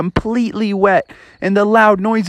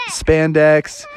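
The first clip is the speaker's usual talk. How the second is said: ordinary delivery, nothing marked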